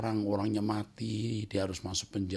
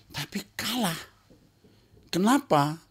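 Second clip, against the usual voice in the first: much higher, louder mock whine; high whiny complaint; 8 dB louder than usual. Preacher mocks a boxer blaming God, high loud whine. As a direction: squeal the loser's complaint.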